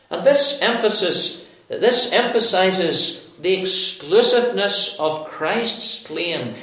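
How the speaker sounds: usual speaking voice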